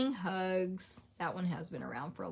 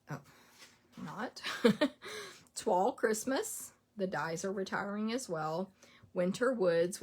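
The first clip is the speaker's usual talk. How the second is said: normal talking voice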